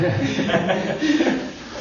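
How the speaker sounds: risada aguda que sobe no fim; risada alta. Real, not acted. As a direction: ri com gosto. Ri ao lembrar do filho. (risada alta que sobe)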